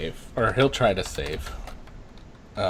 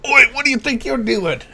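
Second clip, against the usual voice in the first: high, loud Australian shout across the bar; an Aussie wife yelling oi at a stranger; angry Australian woman; 9 dB louder, faster than usual. Acted. GM voices wife catching her husband, loud Australian shout. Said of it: "a female voice go".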